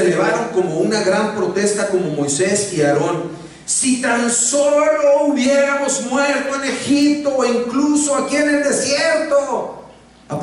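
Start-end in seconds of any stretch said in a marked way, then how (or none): 3.6-9.8 high, slow, wailing lament; wailing Israelites. Retold or imitated. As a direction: moan like a crowd wishing they'd died. Israelitas quejándose, leído. (lamento agudo y lento de los israelitas)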